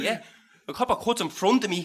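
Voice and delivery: ordinary talk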